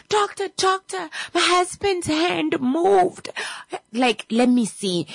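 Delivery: high, slow alarmed wife; wife calling doctor. Retold or imitated. Wife calling the doctor about her husband's hand, high alarmed.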